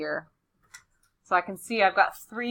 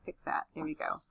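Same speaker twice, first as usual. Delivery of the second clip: muttering; 11 dB quieter than usual. Real, not acted. Muttering while correcting text.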